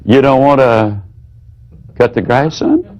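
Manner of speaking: "You don't want to cut the grass, son?" said low, rising on "son". Shown as a demonstration. Parent repeating the teen's refusal, low and questioning. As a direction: drop the voice and turn it into a question. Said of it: "You lower your voice".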